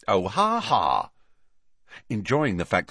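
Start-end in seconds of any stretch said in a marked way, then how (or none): 0.1-1.1 mock laugh spoken as words; a touch of sarcasm; sarcastic laugh. A narrator's reading. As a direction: say it drily, unamused. Dry reply to a tease.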